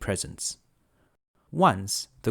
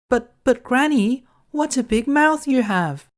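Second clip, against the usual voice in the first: put-on much higher, bright-eyed little girl; 7 dB louder than usual. Girl answering the wolf, high and innocent.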